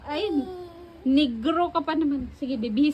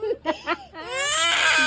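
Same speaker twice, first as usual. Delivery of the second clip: crying wail; 6 semitones higher than usual. Real, not acted. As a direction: wail out in distress. Child upset after torn shirt. (umiiyak nang malakas)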